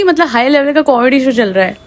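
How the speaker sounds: ordinary delivery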